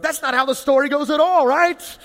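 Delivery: voicing the audience's protest, high and loud; mock audience protest. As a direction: play the shocked congregation objecting. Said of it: "some of you are laughing 'cause you're going".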